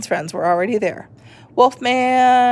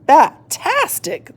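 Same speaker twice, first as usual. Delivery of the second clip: slow, higher cheer; shouting; 7 semitones higher, 4 dB louder, slower than usual. A narrator's reading.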